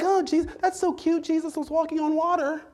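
sudden soft, dismissive "so cute" amid shouting; mock unimpressed. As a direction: drop the fire and sound bored. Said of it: "we're just like".

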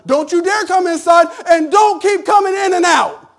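loud, high mock parent shout; parent yelling. Parents shouting at kids to stay outside, loud and high. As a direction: yell like a parent sending kids out. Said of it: "My parents just be like".

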